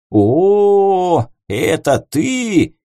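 put-on loud slow drunken shout; drunken yell. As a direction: bellow across the bar. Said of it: "заорал он мне".

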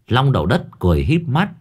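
plain reading voice

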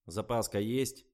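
lightly voiced cheerful mechanic, a bit slow; cheery workman asking. A narrator's reading. Mechanic cheerfully asks the driver about a spare, lightly voiced. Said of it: "весело спросил он".

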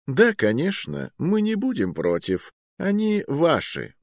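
high, sugary-sweet readiness to oblige; cloyingly friendly. A narrator's reading. Agreeing to give them up, with cloying friendliness. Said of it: "на приторно-доброжелательное".

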